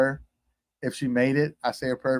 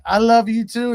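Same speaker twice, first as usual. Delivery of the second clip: high, loud reenacted shout; calling back; 10 semitones higher, 7 dB louder than usual. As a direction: holler it back across a distance. Calling back to departing passenger.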